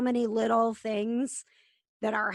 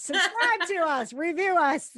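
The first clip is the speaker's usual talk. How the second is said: excited plug; 7 semitones higher, 4 dB louder than usual. Acted. Podcast outro plug, louder higher.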